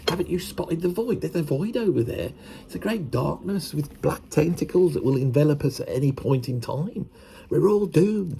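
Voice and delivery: high, hushed, doom-laden; doom-laden creative. Retold or imitated. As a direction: whisper up the doom of the void. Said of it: "they're going".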